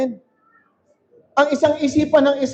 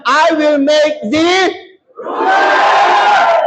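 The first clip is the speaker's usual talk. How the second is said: shouted proclamation; much slower than usual. Shown as a demonstration. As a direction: belt it out for the whole church. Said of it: "Pakilakasan mo yun".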